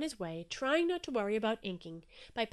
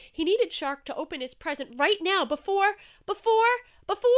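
rising panic; 9 semitones higher, 10 dB louder than usual. A narrator's reading. Panic building before inking.

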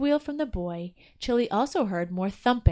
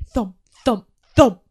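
thumping sound effect; 12 dB louder, slower than usual. A narrator's reading.

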